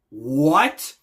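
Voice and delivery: stunned rising exclamation; shocked disbelief. Real, not acted. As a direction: blurt an incredulous question. Shocked at bag over head.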